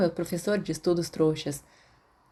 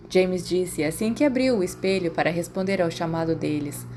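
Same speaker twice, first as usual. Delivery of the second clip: ordinary delivery, nothing marked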